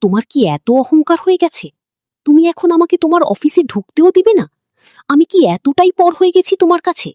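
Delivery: hurt, furious demand; angry. A narrator's reading. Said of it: "Miraj রাগান্বিত কণ্ঠে বলে". (রাগান্বিত কণ্ঠে)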